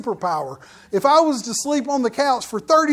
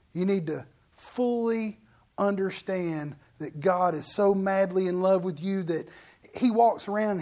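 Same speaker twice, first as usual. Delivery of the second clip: ordinary talk, nothing marked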